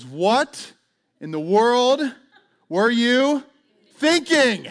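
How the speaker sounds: loud, high, drawn-out parental scolding, acted; exasperated parent. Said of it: "does what every parent does".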